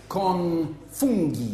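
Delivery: slow, laughing tourist Italian; drawn out con funghi, half laughing; halting phrasebook tourist. Pretend. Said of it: "my Italian is very limited to about".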